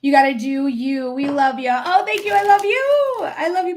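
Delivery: ordinary delivery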